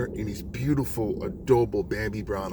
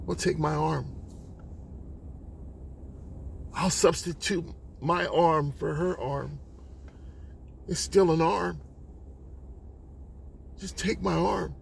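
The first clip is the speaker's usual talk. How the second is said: higher voice, long heavy pauses; grave, resigned offer; 5 semitones higher, 4 dB quieter than usual. Acted. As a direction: offer yourself up, softly after the shouting. Mother offers her arm after pauses.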